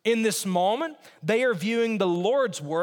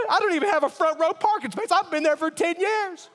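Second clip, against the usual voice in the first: churchgoer complaining; 9 semitones higher than usual. Retold or imitated.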